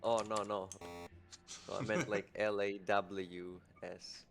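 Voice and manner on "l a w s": L A W S spelled slowly, letter by letter; slow spelling out. Shown as a demonstration. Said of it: "thick Greek accent".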